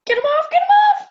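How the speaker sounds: frantic, high, rapid-fire shriek; high panicky outburst; panicked. Acted. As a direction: squeal it loud and fast, desperate to be rid of them. Panics at ticks on socks.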